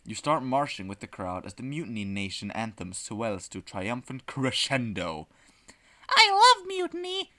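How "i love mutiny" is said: put-on very high, louder childlike shriek; shrieking little girl. Nine-year-old girl at the mutiny parade, shrieking. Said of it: "shrieks a nine-year-old girl".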